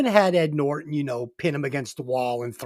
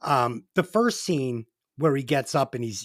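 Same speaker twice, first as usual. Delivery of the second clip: ordinary talk, nothing marked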